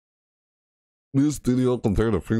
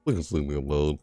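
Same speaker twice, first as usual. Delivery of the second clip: ordinary talk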